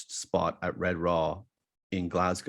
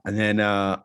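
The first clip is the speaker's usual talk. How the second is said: ordinary talk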